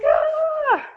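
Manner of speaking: loud, high demonstrated scream. Retold or imitated. Mother re-enacting how loud she got in birth, screaming. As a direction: let out a long, high "Ah!". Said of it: "I remember screaming".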